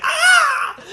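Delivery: very high, laughing cry of a hit soldier; pained death scream. Retold or imitated. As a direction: yelp like a bolt hit your chest. Hun hit by ballista bolt.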